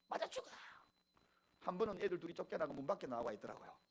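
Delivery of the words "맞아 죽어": hoarse imitation of a screaming mother; mock scream, strained but held down in volume; mother's morning yelling. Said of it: "아침마다 소리 지르는 소리".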